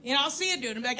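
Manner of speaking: ordinary talk, nothing marked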